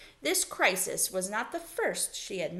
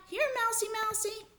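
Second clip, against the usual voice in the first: high sing-song mouse call; very high-pitched calling. A narrator's reading. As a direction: coax a hiding mouse in a high voice. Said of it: "called Lana".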